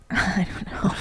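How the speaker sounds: whispered through a laugh. Real, not acted. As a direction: giggle it under your breath.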